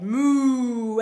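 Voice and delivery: long, loud moo; cow moo. A narrator's reading. Storyteller plays the cow in the farm, long mooing. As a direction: draw out a cow's moo. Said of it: "the cow said".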